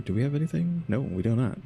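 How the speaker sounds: usual speaking voice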